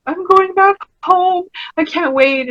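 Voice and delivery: beam it out, eager to go home; excited. Retold or imitated. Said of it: "when I told people".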